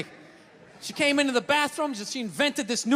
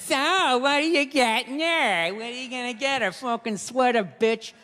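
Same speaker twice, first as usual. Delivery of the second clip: drawn-out mocking chorus of her friends; girlfriends' voices; slower than usual. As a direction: mimic her nosy friends, stretching the words. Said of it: "All her friends".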